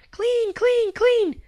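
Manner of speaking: put-on tiny high toddler chant. A narrator's reading. Little sister echoing the family, tiny high voice. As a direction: squeak it like a baby sister. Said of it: "because she is learning".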